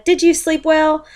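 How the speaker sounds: high, sweet questioning; bright wife voice. Acted. Missus Bear asks cheerfully.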